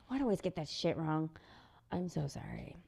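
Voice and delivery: low, soft self-reproach; soft, low aside; sheepish apology. Real, not acted. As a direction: drop to a quiet apology. Apologises for own mistake.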